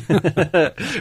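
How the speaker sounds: a bright, high burst of laughter. Real, not acted. Guest laughing that good sausage is missing here, high laugh. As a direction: laugh it off, amused at what's missing.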